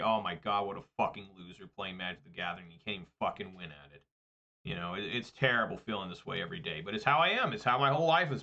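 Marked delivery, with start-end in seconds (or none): 0.0-4.0 much softer, muttering low to himself; self-loathing mutter. Retold or imitated. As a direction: mumble the insult at your own reflection. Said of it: "I just say".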